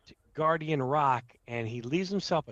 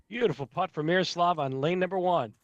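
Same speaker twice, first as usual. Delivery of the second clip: excited; 6 semitones higher, 4 dB louder than usual. Real, not acted. Praising an ace excitedly.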